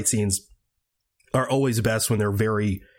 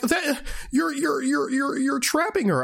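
much higher mocking shout; mock Steve yelling. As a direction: squeal it out like an outraged, sputtering hero. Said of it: "he was like".

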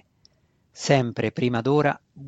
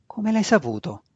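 blurted out; 6 semitones higher than usual. A narrator's reading. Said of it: "sbottò Masoj senza pensare".

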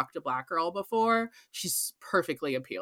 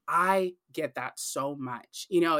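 normal talking voice

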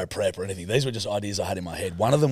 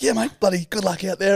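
imitating the boss; 8 semitones higher than usual. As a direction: send him off with a cheery dismissal. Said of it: "But he's like".

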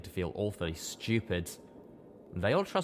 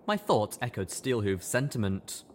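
normal talking voice

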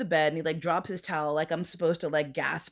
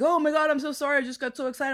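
higher, louder flustered sorry; gushing apology; 8 semitones higher, 4 dB louder, faster than usual. Retold or imitated. The guy apologizing to her after finishing too soon, higher and flustered. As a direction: blurt it out like an embarrassed guy. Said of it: "And he's like".

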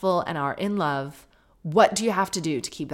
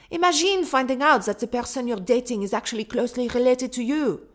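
put-on reading the email in a light French accent, higher; a hint of a French accent, words rolled; playful French accent; 4 semitones higher than usual. Listener's email from Quebec read in a light French accent. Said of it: "I can't do the French accent anymore".

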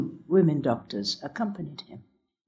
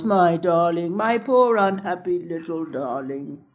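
lightly sing-song endearment; nanny's mild sing-song pity. A narrator's reading. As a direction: croon it gently to a child in arms. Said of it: "say in a sing-song voice".

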